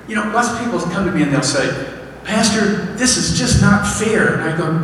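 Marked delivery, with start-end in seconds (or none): 2.2-4.3 high, slow complaint; plaintive, higher-pitched mock complaint; complaining congregant. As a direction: voice an aggrieved churchgoer's protest. Congregant's complaint voiced in sermon.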